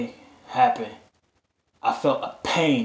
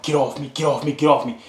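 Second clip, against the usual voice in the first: raised, urgent mock yell; retold yelling; 3 semitones higher, 5 dB louder, faster than usual. Patient fighting off doctors and nurses around them, retold yelling. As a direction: re-enact yelling to be let go. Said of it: "I'm yelling, I'm like".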